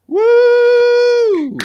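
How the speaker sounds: loud high-pitched cheering whoop; excited whoop. Real, not acted. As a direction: whoop to welcome the guests. Host cheers welcoming guests.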